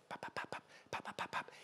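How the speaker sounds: soft unvoiced popping taps; whispered pop pop tapping noise; imitated tapping. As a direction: mimic someone tapping at the door. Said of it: "who's tapping".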